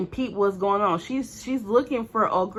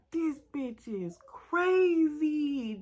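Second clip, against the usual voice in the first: slow stunned disbelief; 6 semitones higher, 5 dB quieter, much slower than usual. Retold or imitated. Horrified at friend's attack.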